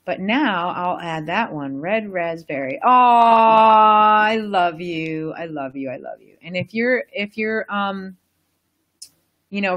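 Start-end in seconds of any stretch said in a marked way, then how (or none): 2.8-5.3 slow, loud, drawn-out gush of love; loving gush. Real, not acted. Speaking lovingly to her new crystal, slow loud adoring gush. Gushing over a raspberry crystal. 5.3-6.3 fading to a murmur. Real, not acted. Cooing to the crystal, fading down to a murmur.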